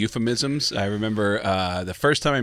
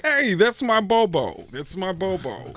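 slow, higher affectionate mock Tracy; Tracy impression; 7 semitones higher, slower than usual. [name] imitating Tracy greeting him fondly, slow and high. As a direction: drag out the fond nickname, pitched up. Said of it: "he'll be like".

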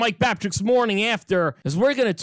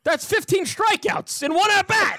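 higher, incredulous; exasperated disbelief; 11 semitones higher than usual. Real, not acted. Telling co-hosts about fifteen strikeouts, incredulous. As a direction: marvel at the absurd count.

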